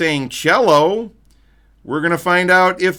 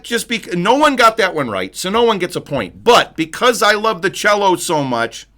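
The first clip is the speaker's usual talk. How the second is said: ordinary talk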